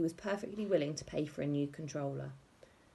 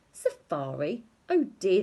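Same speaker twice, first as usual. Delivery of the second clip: louder, condescending oh dear; mock pity; 2 semitones higher, 7 dB louder, slower than usual. A narrator's reading. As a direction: talk down to the younger kids. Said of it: "they said together".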